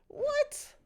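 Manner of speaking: very high loud shriek of disbelief; shrieked what. Real, not acted. Streamer shrieks in disbelief at the screen. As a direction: screech it, rising. Streamer outraged at game ending.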